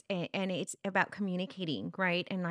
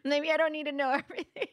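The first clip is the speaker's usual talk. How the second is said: laughing; 8 semitones higher, 4 dB louder than usual. Real, not acted. Laughing about teen secrets.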